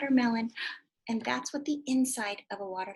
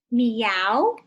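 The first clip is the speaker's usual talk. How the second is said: louder, cat-like meow with a rise; 10 dB louder than usual. Shown as a demonstration. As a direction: meow like a kitty for the kids. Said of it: "what a cat says".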